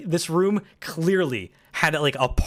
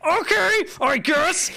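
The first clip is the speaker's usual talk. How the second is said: high resigned okay; 13 semitones higher, slower than usual. Retold or imitated. Resigned reply to airline staff.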